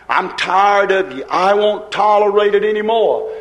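normal talking voice

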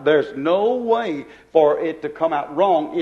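ordinary talk

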